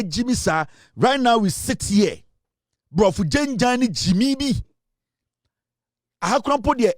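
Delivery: ordinary talk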